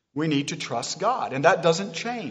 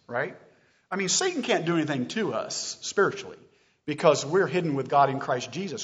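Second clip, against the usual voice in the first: ordinary talk, nothing marked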